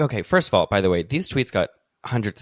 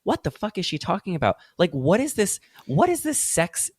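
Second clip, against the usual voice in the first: higher, incredulous reader voice; baffled normal person; 7 semitones higher than usual. Acted. Normal person reading confusing tweets, baffled. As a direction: puzzle it out, pitched up and bewildered. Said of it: "you're reading this and you're like".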